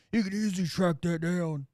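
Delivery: sarcastic mimicry of the commenter; mocking. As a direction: repeat it with mock naive conviction.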